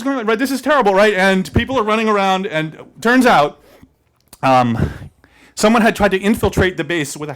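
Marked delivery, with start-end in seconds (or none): none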